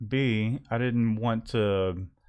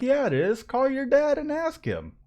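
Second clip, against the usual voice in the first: mocking wife. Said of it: "I'm like".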